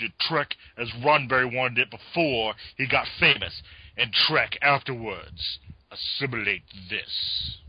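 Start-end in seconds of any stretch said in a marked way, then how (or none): 5.9-7.6 low, quiet Klingon threat; Michael Dorn impression. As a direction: growl it like a Klingon. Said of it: "my halfway decent Michael Dorn impersonation".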